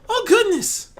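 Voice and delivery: high, loud cry under the weight; startled gasp. Acted. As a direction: gasp out in surprise. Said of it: "she gasped out".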